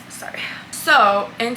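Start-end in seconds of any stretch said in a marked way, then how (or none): 0.1-0.4 whispering. Real, not acted. Apology after long pause.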